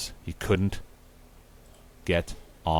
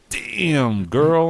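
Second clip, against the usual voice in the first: high, drawn-out catcall; lustful admiration; 8 semitones higher, 3 dB louder than usual. Acted. Will ogling the maid's exposed chest, lustful. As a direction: stretch it into a high whooping leer.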